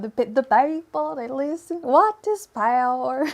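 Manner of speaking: quick hint of mock Swedish, slowing on the question; bad Swedish accent. Host briefly repeating the Swedish line for a viewer, mock accent. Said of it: "Eleanor knows what I'm talking about".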